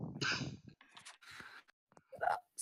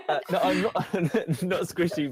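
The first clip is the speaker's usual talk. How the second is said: higher, giggling objection; real laughter breaking up the words; laughing protest; 6 semitones higher than usual. Real, not acted. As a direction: stumble through it while laughing. Laughing protest at being squishy.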